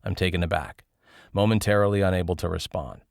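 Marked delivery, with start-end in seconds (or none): none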